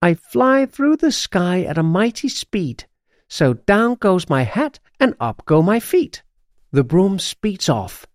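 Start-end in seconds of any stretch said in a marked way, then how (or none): 0.0-6.2 higher-pitched, chanted incantation; reciting a spell. A narrator's reading. Witch astride her broom casting a spell, high recitation. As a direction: declaim the rhyme as a magic spell. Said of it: "recites the spell".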